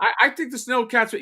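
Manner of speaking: high-pitched impression voice; Ryan Dole. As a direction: slip back into the high mock accent. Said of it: "I'm doing that accent".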